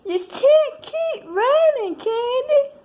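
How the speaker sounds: high, loud, slow mocking voice; mocking quote. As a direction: mimic a nagging relative, high and slow. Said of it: "one person tried to tell me".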